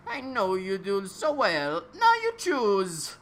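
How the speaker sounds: high, strange put-on old man. A narrator's reading. Old man in the lab greeting Harry, high and odd.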